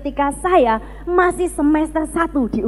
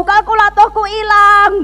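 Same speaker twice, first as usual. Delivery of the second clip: loud, raised mock-desperate cry; shouting with exclamation marks; 5 semitones higher, 5 dB louder than usual. Preacher rereading own diary plea, shouting it. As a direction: read it as an exclaimed prayer. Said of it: "pakai tanda seru".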